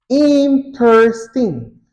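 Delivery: "Interesting" drawn out as in, ters, ting; syllable by syllable. Shown as a demonstration. Teacher repeating an example for learners, slow syllable-by-syllable demonstration. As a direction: say "Interesting" slowly, each syllable set apart. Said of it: "two syllables".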